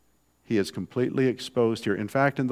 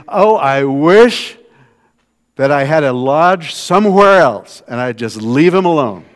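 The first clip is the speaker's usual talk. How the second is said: fed-up prophet; 4 semitones higher, 13 dB louder than usual. Retold or imitated. Preacher voicing Jeremiah's longing to flee his people, exasperated. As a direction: ham up the weary prophet begging to get away. Prophet voiced wanting to escape.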